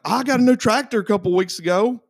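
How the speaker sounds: high, lightly mocking voice; imitating the mechanic. Owner imitating the mechanic bragging about his tractor, high voice. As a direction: mimic him proudly mentioning his tractor. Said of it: "he's like".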